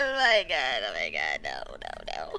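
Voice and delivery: falling low, fading no's; deflated. Real, not acted. Deflating after shriek.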